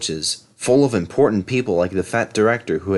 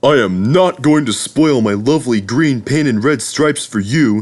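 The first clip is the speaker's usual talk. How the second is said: rude, stubborn engine; 3 semitones higher, 4 dB louder than usual. A narrator's reading. Henry refusing the guard, rude engine voice. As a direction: refuse him rudely. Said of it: "he said rudely".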